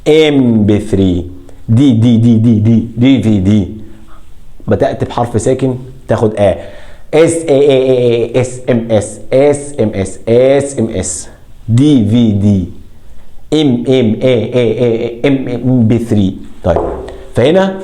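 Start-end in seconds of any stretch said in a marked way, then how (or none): 0.1-3.7 ام بي ثري slow, then دي repeated as a drill; first sounds hammered out in ام بي ثري and دي في دي; going by sound. Shown as a demonstration. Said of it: "بنتعامل بالنطق". 7.1-16.4 first sounds of اس and ام repeated in isolation; letter-by-letter drill of اس ام اس, دي في دي, ام بي ثري; each letter alone. Shown as a demonstration. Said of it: "بنطق كل حرف لوحده".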